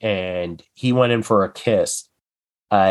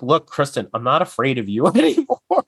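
higher, climbing mock stand-off; mock defiance; 4 semitones higher than usual. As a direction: act out standing up to her, rising to a high held finish. Acting out Kathy defying Kristen.

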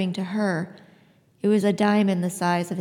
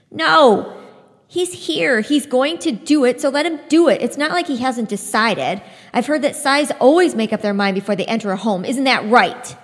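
loud, defiant yelling; furious outburst; 5 semitones higher, 7 dB louder than usual. Acted. Citra shouting defiantly at the scythe, loud and furious. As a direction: rage at the man in your home. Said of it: "by her outburst".

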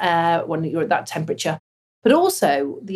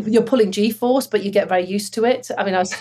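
ordinary talk

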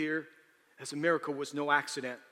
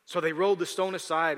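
ordinary talk, nothing marked

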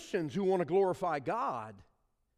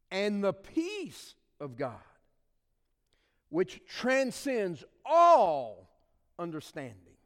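slow solemn reading; 3 semitones higher, 5 dB louder, slower than usual. Acted. Pastor reading the promise of peace to the congregation, solemn. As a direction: linger over the promise of peace. Preacher reads verse slowly.

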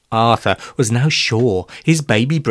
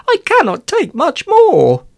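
put-on high, pleading boy's voice; a lightly voiced desperate boy; desperate plea; 4 dB louder than usual. Arthur tells his pal he is desperate, high pleading voice. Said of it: "I'm desperate".

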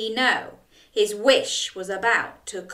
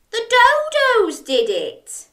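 high, louder insistence on dodos; Jack blaming; 13 semitones higher, 6 dB louder than usual. Acted. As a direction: point the finger, insistently. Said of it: "cried Jack".

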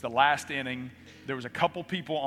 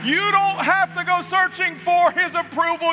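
high, loud preaching at full force; impassioned preaching; 13 semitones higher, 9 dB louder than usual. Acted. Preacher assuring the congregation, loud impassioned preaching. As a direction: drive the promise home to the congregation.

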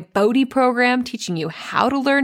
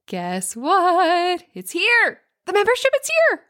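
much higher, sing-out squeal of excitement; excited announcement; 10 semitones higher, slower than usual. Real, not acted. Coach announcing her new membership to moms, excited. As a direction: squeal the big news with rising glee.

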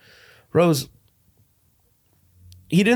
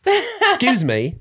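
high-pitched pompous dad voice; a lightly voiced posh dad; mock stern father; 9 semitones higher than usual. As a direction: play the affronted father in his study. Father role in improv sketch.

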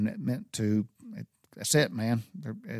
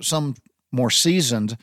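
ordinary talk, nothing marked

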